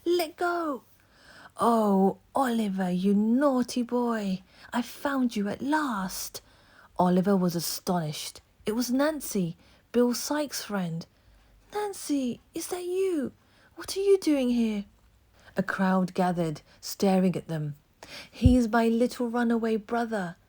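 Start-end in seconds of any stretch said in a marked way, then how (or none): 0.1-0.8 put-on frightened boy. A narrator's reading. Boy seized, cries out. 1.6-6.4 false delight. A narrator's reading. Nancy feigns joy finding boy. 11.7-14.9 put-on astonished boy. A narrator's reading. Oliver surprised at Nancy. 18.3-20.4 silky false voice. A narrator's reading. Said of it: "in a silky, false voice".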